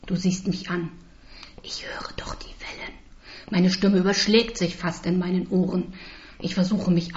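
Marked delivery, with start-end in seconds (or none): 1.6-2.9 tonloses, kraftloses Flüstern; flüstere mühsam; geflüstert. Acted. Patientin flüstert, kann kaum sprechen. (geflüstert)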